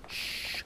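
long soft "shh"; a drawn-out shushing hiss; urgent shush. Acted. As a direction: hush a friend. Hushing friend while mom sleeps.

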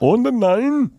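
high-pitched imitation German; mock German accent. Comedian illustrating the accent that terrifies him, high mock German. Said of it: "German accent terrifies me".

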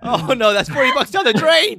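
laugh-wail over wasted money; laughing dismay. Real, not acted. Host about forty wasted bucks, laughing loud. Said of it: "laughing cry of dismay".

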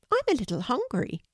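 high, small bird voice; pigeon voice. A narrator's reading. Pigeon speaks.

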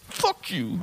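mock resentful curse. Man's curse at the comic imitated.

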